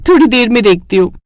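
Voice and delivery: usual speaking voice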